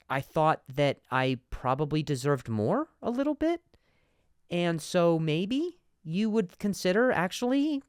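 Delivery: slow, high mock-tentative rises on "funds" and "account"; condescending uptalk. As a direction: make every phrase a question, up to "appreciate that". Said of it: "almost a condescending tone to ask questions".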